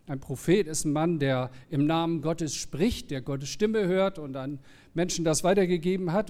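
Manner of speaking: usual speaking voice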